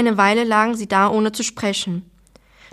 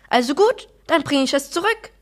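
higher, understated angry retort; angry cry; 5 semitones higher than usual. A narrator's reading. Mother offers to return the gift, mildly angry cry. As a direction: give in crossly, without real shouting. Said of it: "schrie Mama".

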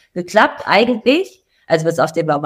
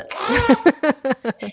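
high-pitched imitation of an overreacting car sensor; mock panic squeal, half laughing; hyperdramatic mock alarm; 9 semitones higher than usual. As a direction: let out a high, hammed-up alarm noise. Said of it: "wie hyperdramatic kann man sein".

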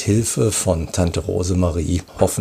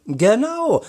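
high, bright-pitched confirmation; confirming; 12 semitones higher than usual. A narrator's reading. Commissioner confirms the outing to boys, emphatic. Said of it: "bestätigte die Kommissarin".

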